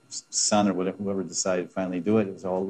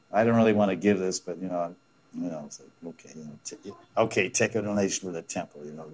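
a hint of grudging reluctance, trailing off; mock begrudging donor; 3 semitones lower, faster than usual. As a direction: mildly act out giving with no real wish to give. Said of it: "begrudgingly giving charity".

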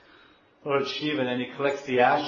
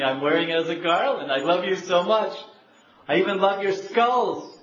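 rising louder and higher as the love pours out; swelling declaration of love; 4 semitones higher, 6 dB louder than usual. Acted. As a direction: build it into a passionate cry. Shiva declaring love to Parvati.

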